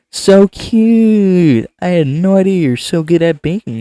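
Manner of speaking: high, loud gushing; excited squeal. Acted. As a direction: squeal it with delight. Voicing Sayori, high loud.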